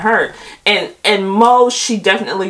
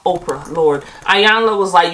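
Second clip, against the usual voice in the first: ordinary talk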